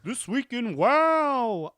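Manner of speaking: high, loud, slow announcer read. Acted. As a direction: boom it like a show title. Announces his segment title.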